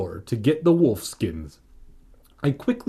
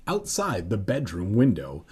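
usual speaking voice

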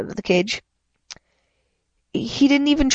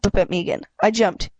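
usual speaking voice

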